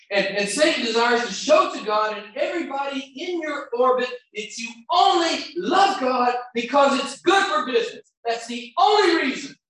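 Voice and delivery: high, loud, urgent preaching; preaching at full force. Acted. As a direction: drive it home to the congregation like a revival preacher. Preaching at full force about Satan.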